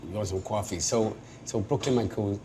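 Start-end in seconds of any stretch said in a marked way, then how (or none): 0.1-0.8 Long Island accent. Shown as a demonstration. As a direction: softly demonstrate Long Island's rounded "coffee" vowel. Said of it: "it's a Long Island".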